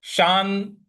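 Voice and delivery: शान pushed with a little force; slow emphatic शान; stress on शान. Shown as a demonstration. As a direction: put weight on शान. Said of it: "यहाँ पे थोड़ा सा बल लगाना है।".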